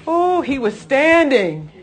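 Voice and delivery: high, loud, drawn-out wonder; exultant exclamation. Real, not acted. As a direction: marvel aloud, slowly and loudly. Preacher marvels, repeating the phrase.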